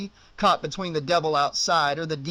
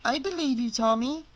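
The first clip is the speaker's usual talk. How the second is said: put-on comforting little girl; 8 semitones higher than usual.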